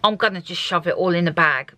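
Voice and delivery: ordinary talk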